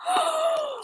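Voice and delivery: sharp, high shocked gasp. Acted. Storyteller reacting as pup speaks up, high gasp.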